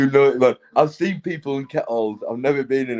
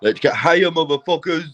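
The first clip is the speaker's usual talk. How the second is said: raised, louder rallying cry with HIGHER pushed up; hyped-up retake; 4 semitones higher, 3 dB louder than usual. Shown as a demonstration. Redoing the line to get it right, hyped-up and raised. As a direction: lift the pitch and belt out "higher". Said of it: "I get that right".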